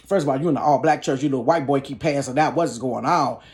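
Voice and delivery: normal talking voice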